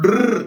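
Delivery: a rolled trilled drrr; rolled r sound. Shown as a demonstration. Teacher demonstrating the trilled r sound, rolled. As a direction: roll the tongue on drrr. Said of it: "the sound".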